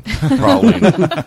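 laughing through a mouthful; high squeaky word with food in mouth; chewing while talking. Real, not acted. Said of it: "I'm chewing on a praline".

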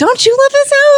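high excited squeal; gushing high. Real, not acted. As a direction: swoon over the house. Gushing about her house.

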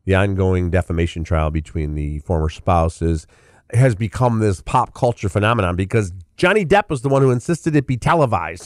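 ordinary delivery

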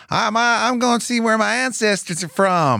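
high, slow, put-on American tourist accent. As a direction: drawl it out like an American tourist off the bus. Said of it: "I don't know if that's a good accent".

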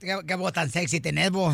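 low, soft voseo character voice; put-on regional character. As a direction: tease the caller in a low put-on voice. Host plays a low-voiced character. (voz grave de personaje)

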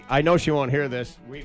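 ordinary talk

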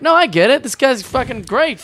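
hammed-up sudden fan; mock convert. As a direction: pitch leaps up in mock delight. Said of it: "you're like".

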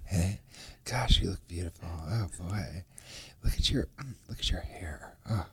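low, hushed seductive croon; breathy, intimate romantic lead; warm smooth leading man. Pretend. Crooning to a woman, pillow-talk.

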